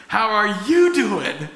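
loud, high, slow and laughing; excited, laughing greeting. Retold or imitated. Preacher reliving the day he could greet people: loud joyful laugh. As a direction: beam the greeting out with joy.

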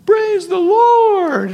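very high, drawn-out far-off call; imitated distant shout. Old coworker hailing him at a concert, imitated distant shout. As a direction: call out slowly as if across a crowd. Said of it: "way far away".

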